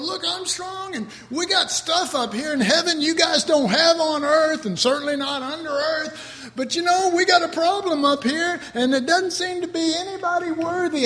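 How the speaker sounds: high, proud angel calling out from heaven; boastful angel. Pretend. Preacher voicing a strong angel boasting to earth. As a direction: puff up like a mighty angel showing off.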